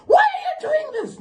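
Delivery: high laughing shriek at the driver; drunk aunt screaming. Retold or imitated. Drunk aunt shrieking at the Uber driver, high and laughing. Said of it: "Now she's screaming out of nowhere".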